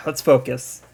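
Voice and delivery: ordinary talk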